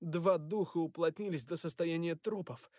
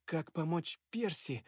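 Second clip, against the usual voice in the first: usual speaking voice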